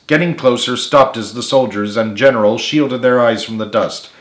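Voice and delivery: ordinary delivery, nothing marked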